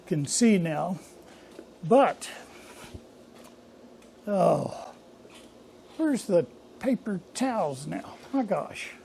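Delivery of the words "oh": ordinary delivery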